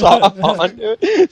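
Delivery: usual speaking voice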